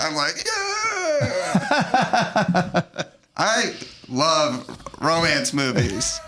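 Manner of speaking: high, drawn-out laughing cry; tearful laugh. Real, not acted. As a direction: let the emotion spill into a long laugh. Overcome by romantic ending.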